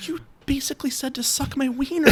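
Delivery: scandalized whisper, very soft; imitated whisper. Embarrassed employee explaining a rude phrase to his boss, whispering. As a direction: whisper it as if it's too rude to say. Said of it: "he whispered the wiener part".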